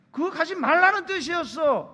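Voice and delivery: high, loud insistence; exasperated protest. Acted. Girlfriend explaining what she meant to boyfriend, exasperated protest. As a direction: argue back in loud frustration. Acted lovers' quarrel.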